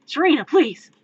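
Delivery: loud, high mock-dramatic plea; exasperated plea. Brooding hero begging partner to stop, loud exasperation.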